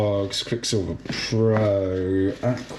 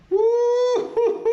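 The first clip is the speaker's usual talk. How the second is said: high whooping cheer; excited whoop. Real, not acted. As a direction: whoop at the wave. Cheering at the wave.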